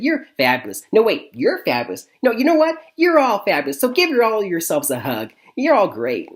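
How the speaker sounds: high, loud bubbly gushing cheer; imitating perky Line. Critic mimics the perky heroine praising everyone, bubbly. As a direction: gush like a relentlessly upbeat teen. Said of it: "she's like that constantly".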